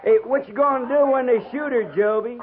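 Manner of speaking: sly needling question; taunting. Acted. Yates taunting Joby about his old mare, sly. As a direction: needle him where it hurts.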